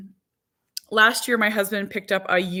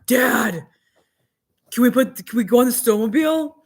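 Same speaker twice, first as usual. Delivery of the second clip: put-on fast, eager kid voice; a young son begging his dad, eager; eager little son; 4 semitones higher, 4 dB louder than usual. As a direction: beg like an excited boy. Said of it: "this is how he talks".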